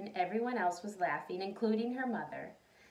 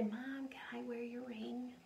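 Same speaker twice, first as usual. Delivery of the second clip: whispered request; 9 dB quieter than usual. Pretend. Child asking mom.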